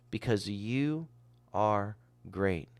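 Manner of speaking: slow "because you are great", a pause before "are"; emphatic. Acted. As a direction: set "are great" apart, slow and weighty. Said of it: "He says it with emphasis".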